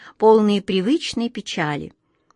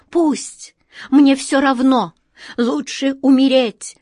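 high, loud despairing shout; desperate defiant cry; 7 semitones higher, 6 dB louder, slower than usual. A narrator's reading. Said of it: "с мужеством отчаяния крикнула Астрель".

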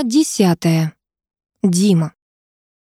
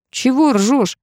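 perked up; 6 semitones higher, slower than usual. A narrator's reading. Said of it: "тут же оживился Ярик".

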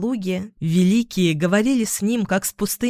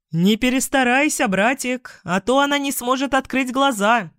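shouting; 6 semitones higher than usual. A narrator's reading. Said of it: "крикнул ему Тин".

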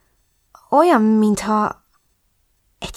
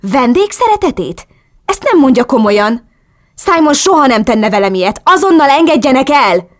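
disbelief building to a loud, high demand; protest, then demand release forcefully; indignant protest; 6 semitones higher, 5 dB louder than usual. A narrator's reading. (felháborodva, követelőzve)

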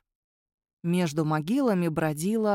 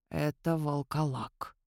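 slow, low stage whisper; hushed whisper; 5 semitones lower, 6 dB quieter, slower than usual. A narrator's reading. Mihai names the creature to companions in hiding, slow whisper. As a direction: whisper it slowly, keeping it down. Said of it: "прошептал Михай".

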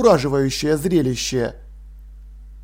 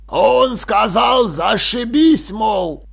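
raised, slow, drawn-out delivery; loud translation; 4 semitones higher, 3 dB louder, much slower than usual. Acted. Translator passes on the mummy's words, loudly. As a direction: relay it loudly, word by word.

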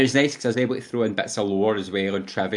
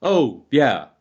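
mock-dismissive game hero, higher and louder; 4 semitones higher, 5 dB louder than usual. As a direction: brush it off breezily, hammed up. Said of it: "so dismissive of the situation".

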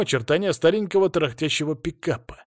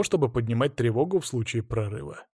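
plain reading voice

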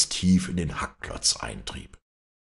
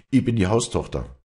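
firm, proud, a little higher; proud declaration; 6 semitones higher, 5 dB louder than usual. Acted. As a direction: state your rank with pride. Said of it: "sagte Walli stolz". (stolz und bestimmt)

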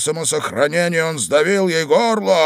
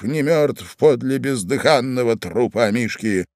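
ordinary delivery

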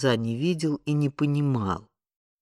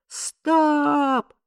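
very high, drawn-out stop; high-pitched exasperated yell. A narrator's reading. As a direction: yell a long cut-off order. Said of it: "заорал Том Дикс".

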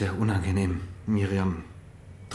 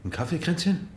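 surprised; 6 semitones higher, 8 dB quieter than usual. Acted. Husband walks in surprised.

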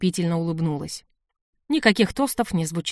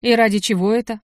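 sharp, louder irritated question; 4 semitones higher, 5 dB louder than usual. Acted. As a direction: snap the question with annoyance. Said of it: "раздражённо спросил Киром".

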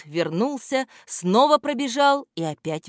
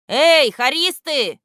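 loud, slow hail across to the singers; calling out; 9 semitones higher, 7 dB louder, slower than usual. A narrator's reading. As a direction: call out to the choir, drawn out. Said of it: "окликнул певцов".

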